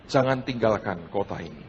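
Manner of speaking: low, soft and solemn; a hushed divine command, spoken low; God's quiet command. Acted. God telling the preacher in prayer not to leave, low and hushed.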